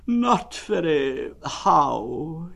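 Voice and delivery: very slow, high, drawn-out mournful donkey; gloomy Eeyore. Acted. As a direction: drag it out like a sad old donkey.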